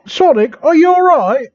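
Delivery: high-pitched worried character voice; a bit of fright. A narrator's reading. Amy checks on Sonic after a pause, a bit frightened. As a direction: ask it high, with a touch of alarm. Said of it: "with a bit of fright".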